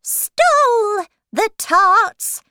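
high, loud, slow proclamation; proclaiming herald. Acted. Reading the charge against the Jack of Hearts, slowly proclaiming. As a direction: draw each phrase out high and loud.

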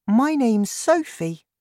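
put-on a relieved small girl; high girl voice, pleased; little girl Sophie. A narrator's reading. Sophie answers.

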